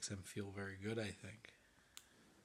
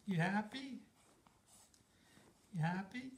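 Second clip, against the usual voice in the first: cooing; 11 semitones higher, 5 dB louder than usual. Real, not acted. Parent talking to baby.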